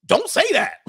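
high, loud mock gasp of disbelief; girl stunned by red pill talk, hammed-up shock. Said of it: "she was shocked".

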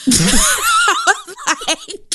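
high laughing burst. Real, not acted. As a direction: laugh it out, stretched slow. Cracking up at the tweet.

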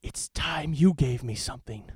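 menacing whisper; villain whisper. Acted. Villain menaces the hero, hammy whisper. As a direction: breathe the threat out. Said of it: "I will be Alex Mercer".

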